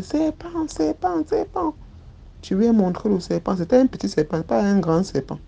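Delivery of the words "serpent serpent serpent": high, panicked cry, climbing higher. Retold or imitated. A child crying out to her nanny in fright. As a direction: shriek the alarm like a scared child. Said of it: "j'ai commencé à crier".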